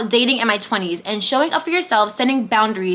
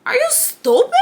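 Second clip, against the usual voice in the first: much higher, incredulous snap; rude jab; 12 semitones higher than usual. Real, not acted. Scolding viewers who seek love elsewhere, rude jab. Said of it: "that was rude".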